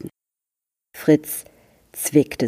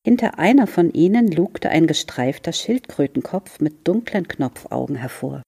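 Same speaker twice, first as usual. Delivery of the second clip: plain reading voice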